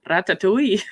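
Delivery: "Ratatouille" rolled out in a hammed-up Scottish accent, mimicking a friend; imitating Logan's put-on Scottish brogue on "Ratatouille"; fake Scottish accent. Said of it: "he always puts on, um, like, a fake exaggerated Scottish accent".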